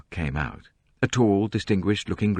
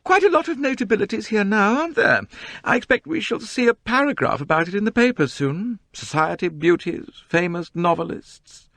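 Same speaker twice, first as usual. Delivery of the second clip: much higher, louder, self-important whine; high, complaining society novelist; 4 dB louder than usual. A narrator's reading. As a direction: complain grandly about the crowd. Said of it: "in her high, complaining voice".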